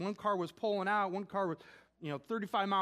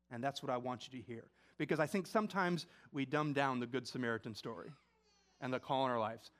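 softer, voice catching with tears; choked up; 7 semitones lower, 4 dB quieter than usual. Real, not acted. Pastor urging the congregation to take up the radical call, softly choked up. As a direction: let the emotion break through quietly. Sermon's emotional closing point.

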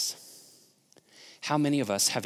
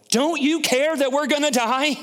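1 panicked disciples; 13 semitones higher, 6 dB louder than usual. Retold or imitated. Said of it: "they go".

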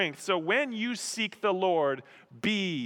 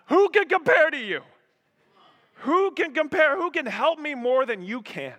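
high, loud appeal to God; impassioned, full-voiced cry of prayer; 12 semitones higher, 7 dB louder than usual. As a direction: call it out passionately to heaven. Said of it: "we cry out to God".

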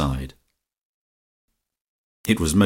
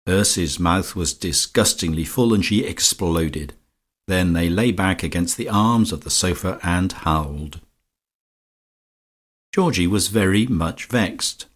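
usual speaking voice

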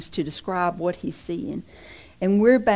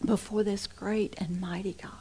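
hushed whisper of awe; breathless reverent whisper; awed whisper; 7 dB quieter than usual. Real, not acted. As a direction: drop to a whisper before God. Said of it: "whispered awe".

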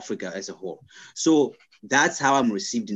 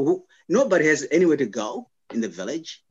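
usual speaking voice

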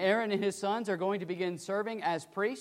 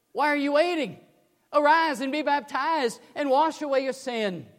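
higher, louder urgent plea; Ananias pleading; 8 semitones higher, 8 dB louder than usual. Retold or imitated. Ananias urging Saul to be baptized, loud pleading. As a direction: urge him to act now. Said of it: "And then Ananias says".

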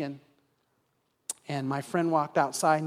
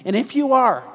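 loud, rising, urgent sermon delivery; full-force preaching; 5 dB louder than usual. Acted. Pastor urging listeners to commit, preached loud and fervent. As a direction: push the appeal hard to the congregation.

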